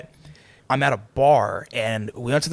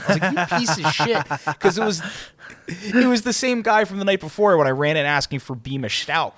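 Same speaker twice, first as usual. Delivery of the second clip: higher-pitched, laughing through the words; 6 semitones higher than usual. Real, not acted. Friend recounting the bartender's trick, laughing. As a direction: tell it while cracking up. Cracking up at own bar story.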